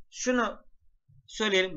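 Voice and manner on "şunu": ordinary talk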